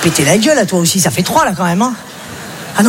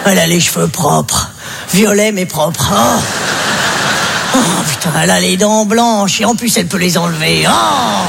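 put-on much lower, slow old-man voice, cooing over his wife; old man doting on Ginette; 6 semitones lower than usual. An old husband sweet-talks his wife Ginette, low and slow. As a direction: croon like a besotted old husband.

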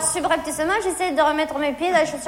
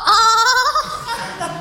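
cry of pain; 6 semitones higher than usual. Retold or imitated. Stepped on her blisters.

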